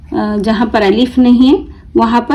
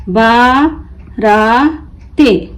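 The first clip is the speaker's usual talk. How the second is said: बाराते spelled slowly as बा, रा, ते; broken into syllables; much slower than usual. Shown as a demonstration. Teacher breaking the word for learners, बा... रा... ते apart. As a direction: pause between each piece of बाराते. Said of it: "तोड़ तोड़ कर पढ़ना सीखें".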